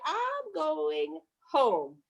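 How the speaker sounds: very slow, stretched, defiant; drawn-out defiant. Retold or imitated. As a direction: stretch every word out stubbornly. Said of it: "She was like".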